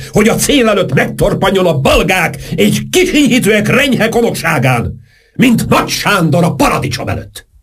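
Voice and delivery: high, quavering grand speech; trembling voice. A narrator's reading. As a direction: quiver through a lofty lecture. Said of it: "mondta Saint-Germain rezgő hangon".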